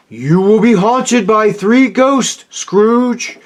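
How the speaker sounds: high, ominous ghostly warning; haunting voice from the grave. A narrator's reading. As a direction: pronounce doom like a spectre. Marley's ghost warns Scrooge.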